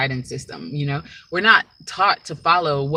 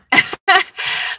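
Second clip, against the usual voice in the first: laugh; 8 dB louder than usual. Real, not acted. Laughs off a sneeze.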